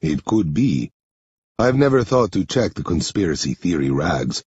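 low, measured man's voice; low-pitched, deliberate character voice; Henri character voice. Acted. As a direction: play Henri low and subdued, slightly foreign.

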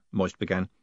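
usual speaking voice

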